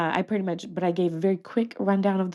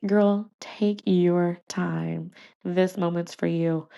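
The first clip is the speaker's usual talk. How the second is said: slow, deliberate friend's advice; unhurried reassurance; much slower than usual. Retold or imitated. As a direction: draw it out to steady her.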